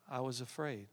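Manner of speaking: hushed, fearful little voice; timid. Retold or imitated. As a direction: say it like a scared child caught out. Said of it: "why are you talking like that".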